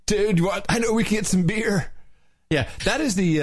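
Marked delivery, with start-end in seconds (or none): 0.0-1.9 high-pitched surfer dude; pitched-up stoked surfer. Shown as a demonstration. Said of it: "the award-winning surfer dude voice".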